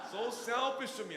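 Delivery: an overwhelmed, loud confession; emotional outburst. Real, not acted. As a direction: cry it out, overwhelmed. Realizing his own selfishness.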